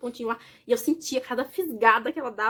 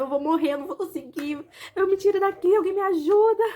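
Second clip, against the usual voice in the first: higher and louder, a mock cry for help; imitated desperate plea; 6 semitones higher, 4 dB louder than usual. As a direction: relive the panic of labor, pleading. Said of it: "no dia, eu falava". (súplica desesperada imitada, mais aguda e alta)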